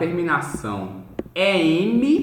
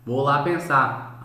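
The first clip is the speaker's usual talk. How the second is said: ordinary delivery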